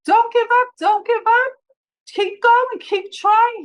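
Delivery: retold panicked plea over a dying patient; frantic pleading. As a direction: beg her to keep going in a panic. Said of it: "He was crying, frantic".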